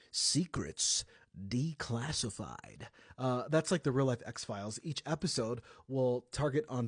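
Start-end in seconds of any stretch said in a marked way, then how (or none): 0.1-2.9 slow, brooding announcer voice; brooding voiceover. Shown as a demonstration. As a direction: draw each word out like a dark trailer. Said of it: "in my brooding voice, I would say".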